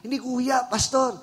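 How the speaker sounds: high, loud pleading excuse; mock sheepish visitor. Visitor confessing to the pastor, high sheepish pleading. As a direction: squeak out the excuse like a caught thief. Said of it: "di ko nga alam ba't ako nag-church".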